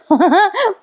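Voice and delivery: high acted scoffing laugh; mocking villain laugh. Mahipat scoffing at faith in Rakesh, mocking laugh.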